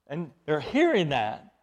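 normal talking voice

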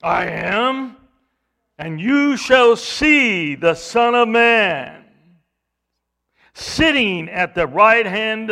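loud, slow proclamation; Jesus prophesying before the council, loud and slow; solemn prophecy. Acted. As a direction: proclaim it grandly and slowly. Jesus quoting Daniel to Caiaphas.

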